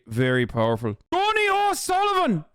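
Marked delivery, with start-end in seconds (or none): 1.1-2.5 put-on high-pitched, showy Irish accent. Streamer showing off his Irish accent, high Irish lilt. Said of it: "my Irish accent is actually".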